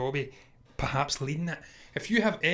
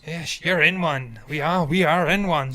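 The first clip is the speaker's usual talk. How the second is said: slow, louder hammed-up Bristol pirate accent; mock Bristol pirate accent; 8 dB louder, slower than usual. Host answering co-host's pirate quote in a mock Bristol accent. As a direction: growl it out like a West Country pirate, slowly and loud. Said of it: "doing my sort of Peter Mayhew Bristol accent".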